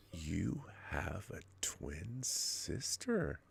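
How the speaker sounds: slow, lascivious hushed whisper; sleazy whisper. Pretend. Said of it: "The most sleazy and lascivious way possible".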